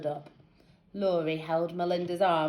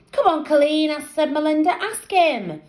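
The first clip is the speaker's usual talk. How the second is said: put-on girl voice; 10 semitones higher, 4 dB louder than usual. A narrator's reading. Friend urging Colleen.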